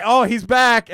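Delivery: high, loud mock warning; mock alarm. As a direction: call it out high and loud as a sarcastic alert. Mock warning he would give.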